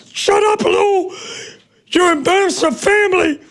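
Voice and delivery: high, scolding mother voice. Retold or imitated. Mother scolding her son from the house, high scolding. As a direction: scold him for shaming the family.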